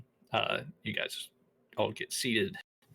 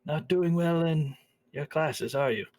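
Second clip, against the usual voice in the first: put-on an older woman seeing through his excuse; knowing old lady; 5 semitones higher than usual. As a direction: tease him gently, then offer help. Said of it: "She says".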